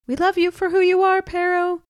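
high, loud, quick call from the crowd; a bystander yelling encouragement; yelled out. A narrator's reading. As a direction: shout support from the back. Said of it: "someone yelled out".

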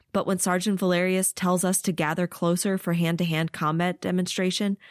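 ordinary delivery

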